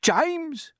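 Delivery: high-pitched desperate call; panicked cry. A narrator's reading. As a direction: call out as a frightened earthworm. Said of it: "cried the earthworm".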